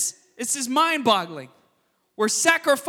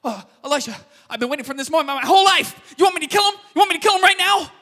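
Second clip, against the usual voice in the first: the king of Israel, rising excitement, louder at the end; eager king; 5 semitones higher, 5 dB louder, much faster than usual. Retold or imitated. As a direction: play a king who can't wait to strike. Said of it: "the king of Israel comes out like".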